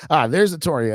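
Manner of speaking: ordinary talk, nothing marked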